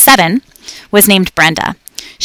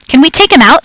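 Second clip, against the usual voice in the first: put-on higher, louder excited kid; excited child scream; 7 semitones higher, 4 dB louder than usual. A narrator's reading. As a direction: blurt it out like an eager little boy. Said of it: "screamed Willie".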